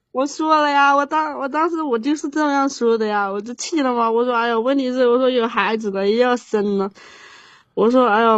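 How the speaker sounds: normal talking voice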